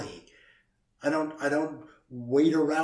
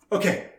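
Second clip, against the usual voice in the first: loud high laugh; 7 semitones higher, 8 dB louder than usual. Real, not acted. Host cracking up over not being ready, laughing loudly.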